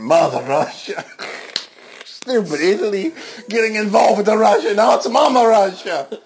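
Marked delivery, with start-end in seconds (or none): 2.0-6.2 high, squeaky comic Russian voice; hammed-up Russian accent; mock Russian woman. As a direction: sneer at Italy like a Russian babushka.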